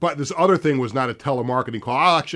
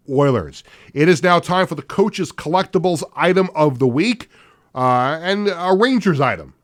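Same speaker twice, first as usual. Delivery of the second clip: ad read; 4 semitones higher, 7 dB louder than usual. Acted. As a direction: sell the tickets like a radio pitchman. Reads sponsored segment and schedule.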